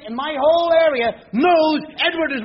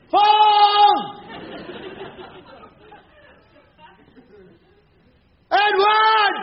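yelling up the stairs; 7 semitones higher, much slower than usual. Retold or imitated. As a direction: bellow the name up the stairs so the son hears.

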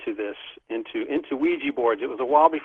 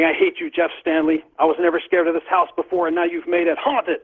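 raised, higher mock yell of accusation; imitating angry yelling; 6 semitones higher, 4 dB louder than usual. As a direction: retell a friend's upset outburst, pitched up. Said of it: "Robin's yelling at me".

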